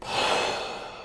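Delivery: long, airy exhale; heavy release of breath; long sigh. Real, not acted. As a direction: let the breath out audibly after finishing. Coming out of the channeling.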